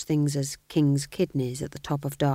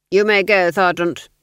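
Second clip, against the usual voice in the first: louder, clipped aristocratic command; cold Duke; 2 semitones higher, 9 dB louder than usual. Acted. Duke dismissing the sergeant: louder cold command. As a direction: dismiss him like a bored lord.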